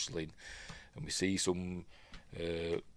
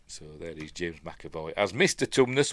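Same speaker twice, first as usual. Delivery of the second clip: ordinary talk, nothing marked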